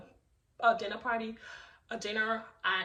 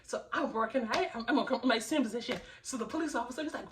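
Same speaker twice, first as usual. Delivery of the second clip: ordinary delivery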